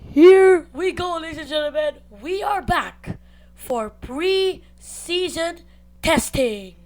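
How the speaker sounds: slow, drawn-out announcer opening; announcer intro. Acted. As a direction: stretch it like a show host.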